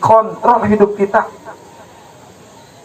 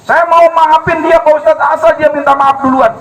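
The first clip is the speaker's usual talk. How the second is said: indignant demand; 7 semitones higher, 3 dB louder than usual. Retold or imitated. Stubborn congregant demanding apology.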